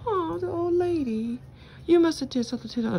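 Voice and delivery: high, fast mock-sympathetic voice; mock pitying cops. Narrator mocks cops pitying old lady, high mock-sympathetic voice. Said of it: "they'll be like".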